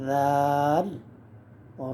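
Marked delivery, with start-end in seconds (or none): 0.1-0.9 দাল with its vowel held very long and even; held four alif long. Shown as a demonstration. Teacher demonstrating a letter name to beginners, long and held. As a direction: sing out দাল, long and steady. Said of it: "দাল চার আলিফ".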